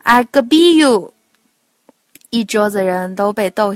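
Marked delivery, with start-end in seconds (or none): 0.0-1.1 louder, higher triumphant granny voice, mangling "I love you" as "I could be you"; an old lady proudly blurting her garbled English; proud old grandma. Acted. Grandma at the dinner table, proudly garbled English. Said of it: "外婆终于想起来了".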